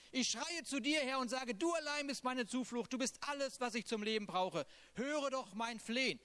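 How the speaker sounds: impassioned reading of a lament; psalm reading. Acted. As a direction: pour the psalm out like a plea. Reads Psalm 142 aloud.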